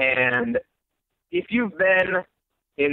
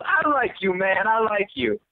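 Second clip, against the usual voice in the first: delighted crazy stranger; 8 semitones higher than usual. Retold or imitated. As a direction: mimic a stranger who is suddenly tickled by you. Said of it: "just starts laughing and laughing".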